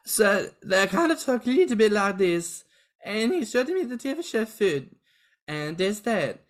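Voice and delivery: high, silly mock Swedish accent. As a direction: put on a comic Swedish accent. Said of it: "[name] doing a Swedish accent equivalent".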